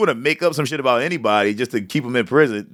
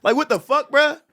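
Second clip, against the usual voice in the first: incredulous; 12 semitones higher than usual. Real, not acted. Incredulous reaction.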